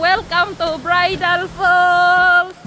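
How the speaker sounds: slow, loud host announcement; vlog welcome. Real, not acted. Vlogger welcoming viewers to the falls, slow proud announcement.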